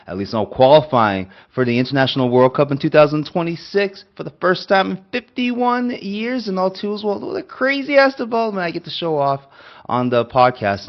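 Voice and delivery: thrilled, showing off at full pitch; excited celebration. Real, not acted. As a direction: celebrate the news, voice soaring way up. Celebrating historic World Cup qualification.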